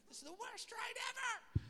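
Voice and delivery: high, loud, whiny friend complaining; mock whiny shout. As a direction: ham up a fed-up passenger yelling. Trapped friend complains in car.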